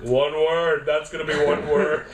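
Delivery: loud imitating promo voice; mock announcer. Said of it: "I'm gonna do this all in one breath".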